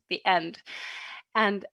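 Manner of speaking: ordinary talk, nothing marked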